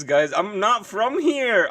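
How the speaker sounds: usual speaking voice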